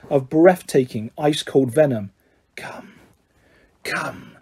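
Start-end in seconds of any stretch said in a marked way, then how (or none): 2.6-4.4 slow, icy, unvoiced whisper; chilling whisper. A narrator's reading. A hidden voice calls to Harry, in a chilling whisper. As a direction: whisper it like venom from the walls. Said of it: "A voice to chill the bone marrow".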